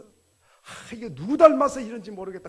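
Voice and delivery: put-on loud grumble at a child; a fed-up parent blaming whoever the kid takes after; mock exasperated parental outburst. Parent complains about child.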